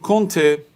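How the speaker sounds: کنته with its first vowel sent through the nose; teacher modelling the nasal vowel in کنته; nasal vowel demo. Shown as a demonstration.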